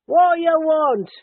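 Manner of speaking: loud, high, slow shouted reply; answering shout. Acted. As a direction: yell it back from the kitchen. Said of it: "came the answering shout".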